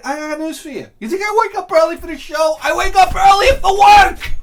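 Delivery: climbing to a high, loud yell; indignant shouting rant. Real, not acted. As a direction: build it into an outraged shout. Ranting about working a full day.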